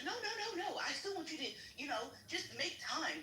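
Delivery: high-pitched imitation of a hedging boss; mock backpedaling. Sergeant correcting a worker's misunderstanding, high mock backpedaling.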